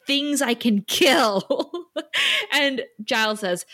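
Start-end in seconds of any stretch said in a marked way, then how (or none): 0.1-1.7 drawn-out slow threat; slow menace. Retold or imitated. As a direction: savour the words. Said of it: "Spike's like".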